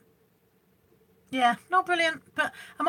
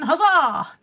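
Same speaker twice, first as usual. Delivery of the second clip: a louder, higher cheer; 6 semitones higher, 7 dB louder than usual. Real, not acted. Welcoming a regular viewer to the live stream with a cheer. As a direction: greet him with a little whoop.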